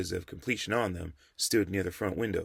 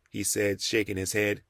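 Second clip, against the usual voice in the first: ordinary delivery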